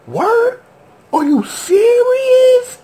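very high, loud, slow incredulous cry; retold disbelief. Recalling his reaction to the reveal, high loud incredulous cry. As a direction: shriek it, unbelieving. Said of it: "I'm like".

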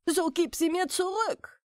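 high, urgent demand; demanding shout. Acted. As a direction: insist he hands them back. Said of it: "schrie der Hirt".

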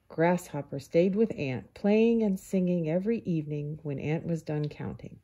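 usual speaking voice

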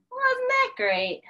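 sarcastic. Real, not acted. Sarcasm about snow.

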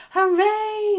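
high, loud cheer; a bunny cheering the vote result. A narrator's reading. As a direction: shout hooray with joy.

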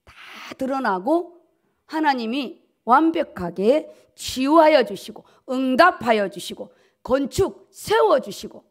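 ordinary talk, nothing marked

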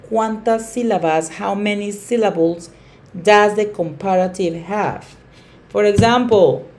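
normal talking voice

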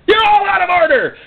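high, crying mock shout; hammed-up indignant yell; outraged shout. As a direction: cry out over a squabbling crowd. Acted quarrel reaching its peak.

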